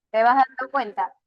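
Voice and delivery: normal talking voice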